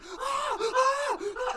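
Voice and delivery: high mock panic shrieks; hammed-up high-pitched panic; panicked screams. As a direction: scream like a terrified clerk. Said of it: "she's panicking and freaking out".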